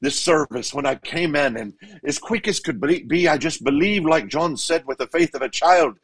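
put-on elderly Irish priest accent; Irish brogue. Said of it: "he said it in his beautiful Irish brogue".

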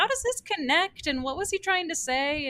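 high-pitched mock bafflement; puzzled questioning. Retelling puzzled after-movie conversation.

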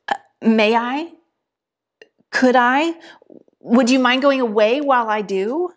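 lightly voiced, hesitant girl asking permission; timid girl Jill. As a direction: ask meekly, unsure if it's allowed. Timid Jill asks the lion.

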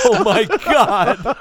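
slow, drawn-out laughing "Oh my God"; laughing disbelief. Real, not acted. Cracking up at a friend's joke, slow laughing disbelief. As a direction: let it stretch out through laughter.

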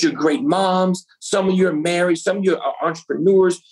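ordinary delivery

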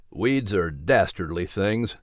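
put-on deep grave voice of an old pastor; Reverend's voice. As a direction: muse gravely on weeds. Reverend's low voice.

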